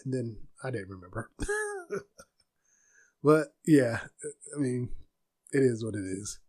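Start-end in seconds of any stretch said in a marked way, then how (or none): 1.3-2.0 short, high, soft laugh; small self-mocking chuckle; real laugh. Real, not acted. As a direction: laugh at your own forgetfulness. Laughs at own forgetting.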